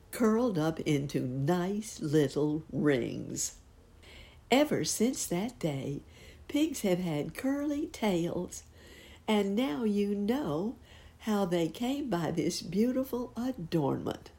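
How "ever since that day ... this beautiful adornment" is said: slow, high, fond wrap-up; slow storytelling ending. A narrator's reading. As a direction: close the tale slowly and fondly. Story's closing moral.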